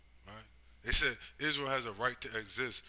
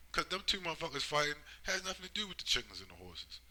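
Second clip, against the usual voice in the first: ordinary talk, nothing marked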